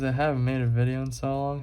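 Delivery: ordinary delivery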